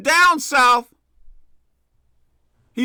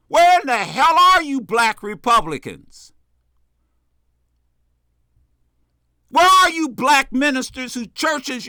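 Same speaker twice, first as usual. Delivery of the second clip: loud, impassioned calling-out; real outrage, punching the key words; indignant challenge; 4 dB louder than usual. Real, not acted. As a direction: demand an answer from those staying silent.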